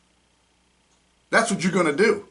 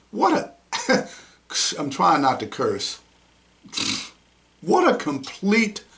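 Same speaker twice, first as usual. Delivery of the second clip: normal talking voice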